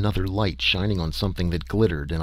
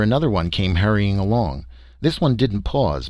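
ordinary delivery

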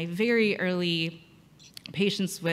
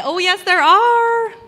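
loud, high, drawn-out oh yes; gleeful loud insistence; playful insistence; 12 semitones higher, 12 dB louder than usual. Retold or imitated. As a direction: sing it out teasingly, much higher and louder. Insisting to the patient.

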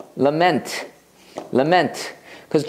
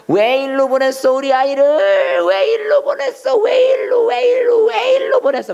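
hammed-up wailing; 3 dB louder than usual. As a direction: wail like a bereaved father, climbing to a piercing pitch. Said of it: "거의 막 통곡하면서 우는 거".

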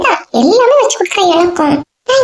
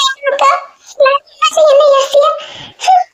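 crying wail; 6 semitones higher than usual. Acted. Woman wailing to her brother that they were left without food.

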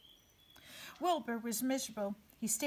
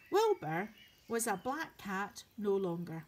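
ordinary delivery

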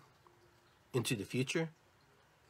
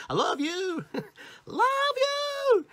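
very high squeal with laughs between, building louder; hammed-up high love you, growing louder; silly gushing; 5 dB louder, much slower than usual. Shown as a demonstration. Speaker mocks cheesy love, high playful squeal.

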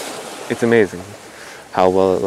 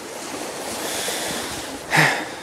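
heavy sigh; 7 dB quieter than usual. Real, not acted. Cold, unprepared, sighs.

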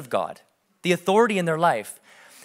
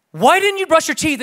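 louder, much higher angry question; angry dad; 10 semitones higher, 5 dB louder than usual. Retold or imitated. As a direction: demand it like a fed-up father. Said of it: "And then I start getting angry".

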